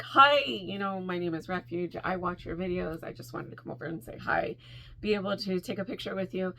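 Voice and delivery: soft, low, understated introduction; calm. Retold or imitated. Fan introducing herself to a creator, calm and quiet. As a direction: imitate keeping cool, voice dropped and soft. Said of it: "I was really calm".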